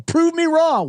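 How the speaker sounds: high, smug taunt; a mocking dare aimed at a stubborn online arguer. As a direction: sneer it like a know-it-all troll. Acting out a ridiculous claimer.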